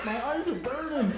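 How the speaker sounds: put-on high-pitched kid complaint; lightly voiced eight-year-old whining; mock childish protest. Eight-year-old self complaining in smoky van.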